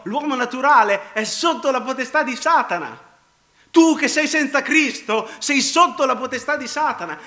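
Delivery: loud, high, driving pulpit voice; full-force preaching. Real, not acted. Preacher warning those without Christ of Satan's power, full-force preaching. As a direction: drive each phrase home to the sinner.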